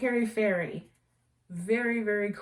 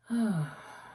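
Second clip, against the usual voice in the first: dreamy sigh. Real, not acted. Savoring candle scent.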